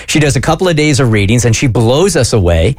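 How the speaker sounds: normal talking voice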